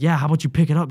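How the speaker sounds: loud, high, taunting command; drill instructor's order. Retold or imitated. Drill instructor after dumping tape, loud taunting order. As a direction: order the recruit to clean up.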